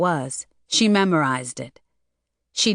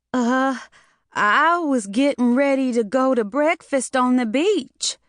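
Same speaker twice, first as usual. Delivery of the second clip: trembling voice; 6 semitones higher than usual. A narrator's reading. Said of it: "Mona's voice was trembling".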